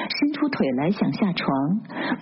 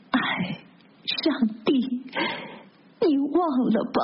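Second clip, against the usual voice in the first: higher, halting groaned words; moaning; 7 semitones higher than usual. A narrator's reading. Delirious patient addressing God after a laughing fit, moaning. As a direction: moan it weakly, with pauses between phrases. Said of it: "哼唧着说".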